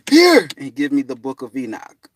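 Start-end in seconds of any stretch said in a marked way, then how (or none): 0.1-0.5 high, loud eager call; eager exclamation. Retold or imitated. Dad handing him the book, loud and eager. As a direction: thrust it forward eagerly.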